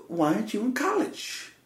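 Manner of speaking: put-on high, soft Greek-accented probing; intense Greek accent. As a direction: imitate a Greek man pressing for an answer. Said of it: "he's getting all intense with me".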